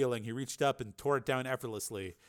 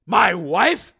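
loud, higher, taken aback; startled question; 8 semitones higher, 13 dB louder than usual. Acted. Steele hearing news of his wife, startled. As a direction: blurt it out in disbelief.

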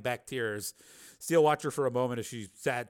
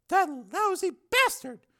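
slow, high female voice, bitter; bitter woman; 5 dB louder, slower than usual. Acted. Susan about the man who deceived her, bitter. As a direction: curse him slowly, high-pitched. Susan learns she was betrayed.

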